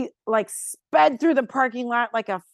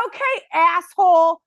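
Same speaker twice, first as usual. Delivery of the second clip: louder, higher, fed-up snap; angry retort; 5 semitones higher, 5 dB louder than usual. Retold or imitated. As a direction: tell him off to his face. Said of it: "And I go".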